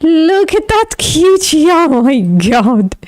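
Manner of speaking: high, slow cutesy coo; high drawn-out cooing at a cute cat photo; cooing over a cat. Real, not acted.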